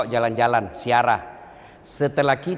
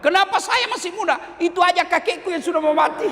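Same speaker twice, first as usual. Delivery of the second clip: high, whiny cheeky youngster; bratty kid. Acted. Preacher voicing a modern kid dodging his father, high and whiny. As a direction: talk back like a spoiled kid.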